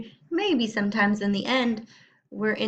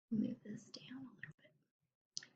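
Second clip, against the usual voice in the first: unvoiced whisper to the side; whispered aside; 3 semitones higher, 19 dB quieter than usual. Real, not acted. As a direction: drop to a whisper, off the story. Teacher aside while reading aloud.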